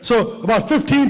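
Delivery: usual speaking voice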